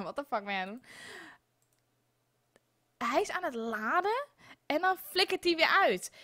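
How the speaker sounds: ordinary delivery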